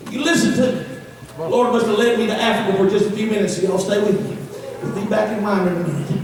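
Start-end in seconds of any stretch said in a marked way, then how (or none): none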